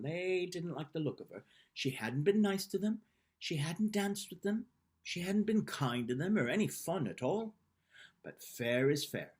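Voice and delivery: ordinary delivery, nothing marked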